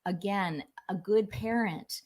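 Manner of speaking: ordinary delivery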